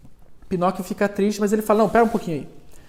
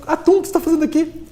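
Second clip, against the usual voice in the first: higher and fast, caught off guard; surprised cry; 9 semitones higher, much faster than usual. Acted. Pinocchio spots the tuna at sea, high and rushed surprise. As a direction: blurt it out in amazement.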